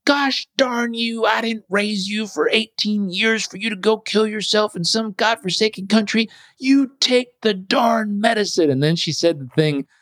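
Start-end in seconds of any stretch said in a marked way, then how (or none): 0.0-8.7 high scolding mother voice; angry mom. Retold or imitated. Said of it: "she did what moms do".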